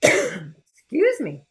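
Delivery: strained, pre-sneeze voice; about to sneeze. Real, not acted. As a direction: hold back a coming sneeze. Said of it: "I'm gonna sneeze".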